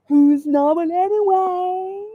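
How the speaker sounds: "anyway" drawn out and rising; slow, stretched "Who's normal anyway" climbing on ANYWAY; theatrical. Acted. Repeated theatrically with rising ending.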